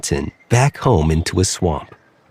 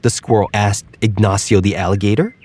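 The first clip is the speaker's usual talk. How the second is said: plain reading voice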